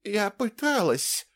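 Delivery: high, slow pitiful voice; plaintive. A narrator's reading. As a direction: say it as a helpless complaint. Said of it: "жалобно произнесла Ингрид". (жалобно)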